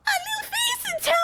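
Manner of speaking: extremely high, airy squeak; top of the range. Shown as a demonstration. Streamer trying a friend's voice advice, airy top-range voice. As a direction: push it to your highest pitch with lots of air. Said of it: "Top range of your voice and keep that tone with a ton of air".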